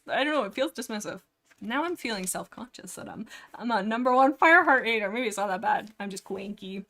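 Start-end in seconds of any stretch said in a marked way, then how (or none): none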